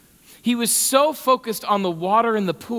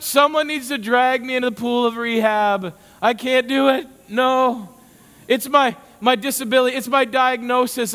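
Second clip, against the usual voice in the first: mock whining; 3 semitones higher than usual. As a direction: whine through excuses with self-pity. Mocking modern excuses.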